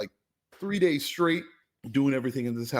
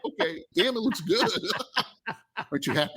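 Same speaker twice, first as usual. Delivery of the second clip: higher, giggling through the words; laughing mock praise; 11 semitones higher than usual. Contractor voices the impressed clients, laughing. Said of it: "And they're like".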